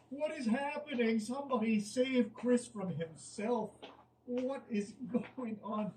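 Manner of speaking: high, drawn-out bewildered plea; exasperated disbelief. Real, not acted. Reader begging someone to save Chris, high exasperated disbelief. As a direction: plead in high-pitched, comic despair. Despairing at the chat.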